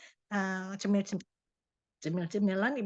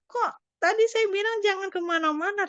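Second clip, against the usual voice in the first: high, louder fretting to herself; retold dismayed protest; 13 semitones higher, 6 dB louder than usual. Daughter reacting to mother's fall, retold dismayed outcry. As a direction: voice jumps up in upset disbelief, retold.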